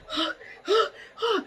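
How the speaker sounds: high, sharp gasps; acted hyperventilating. Storyteller replaying her hyperventilating, high gasps. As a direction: re-enact panicked gulps of air. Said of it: "I'm hyperventilating".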